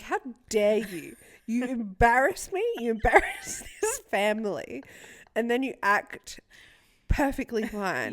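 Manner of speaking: mock indignant rant; mock scolding. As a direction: scold the dog like an outraged parent. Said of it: "I was like".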